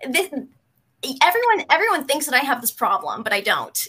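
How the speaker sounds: defiant denial. Retold or imitated. Retelling her denial to the clinic staff, loud, high and indignant. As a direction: fire it back like a defiant teen. Denying anorexia to staff retold.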